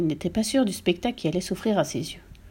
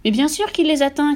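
high, raised outcry; cri de la mère; 7 semitones higher, 4 dB louder than usual. A narrator's reading. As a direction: cry it out, exasperated. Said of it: "cria la mère". (cri aigu, exaspéré)

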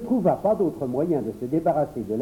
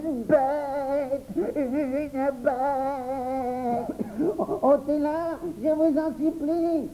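high, pleading cries of fear; terrified; 10 semitones higher, much slower than usual. Acted. The boy tells the children there is a beast. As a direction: beg in panic.

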